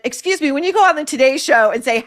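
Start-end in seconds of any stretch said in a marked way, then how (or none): none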